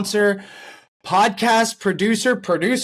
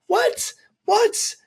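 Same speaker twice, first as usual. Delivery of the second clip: shrill squeaky disbelief, much higher; mock outrage. Podcast host mocking the Cavs' disrespect, outraged squeak. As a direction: play up the fake shock at the insult.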